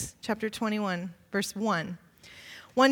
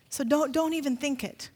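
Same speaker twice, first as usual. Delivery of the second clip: normal talking voice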